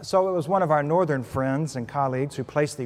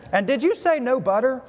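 disbelieving Southern clerk; 8 semitones higher than usual. Retold or imitated.

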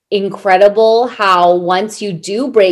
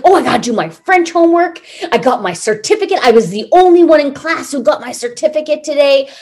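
higher and fast, bubbling with pride; proud kid; 5 semitones higher, faster than usual. Retold or imitated. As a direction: rattle it off like a thrilled little boy. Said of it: "He's like".

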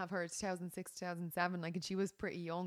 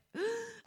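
excited gasp. Retold or imitated. Excited to meet a German.

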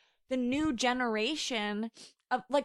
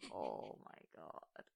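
slow whispered disbelief; retold awestruck whisper; hushed reaction; 17 dB quieter, slower than usual. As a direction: breathe it out slowly, barely voiced.

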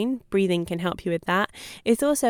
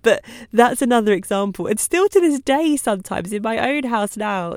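normal talking voice

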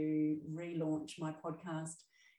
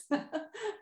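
short high giggle; a quick amused laugh; light laugh; 3 dB louder than usual. Real, not acted. Laughs at her own quip.

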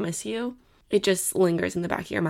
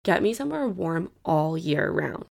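usual speaking voice

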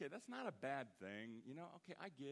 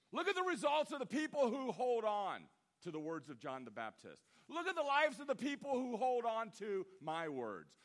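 loud, rising preacher's build; preacher challenging the doubters, loud and building; preaching at full force; 7 semitones higher, 8 dB louder than usual. Acted. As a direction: hammer each "Look at" harder.

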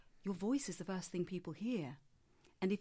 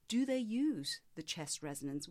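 ordinary delivery

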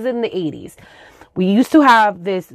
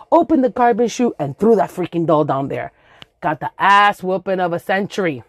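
usual speaking voice

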